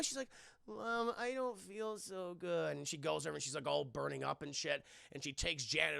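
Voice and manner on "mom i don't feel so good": put-on slow, softer child voice; imitating an unwell child; sick little girl. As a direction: drag it out feebly to mom. Sick Janet to mom.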